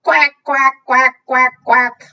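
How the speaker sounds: quick run of loud duck quacks; noisy duck. Acted. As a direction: quack noisily like a storybook duck. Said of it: "Duck is noisy".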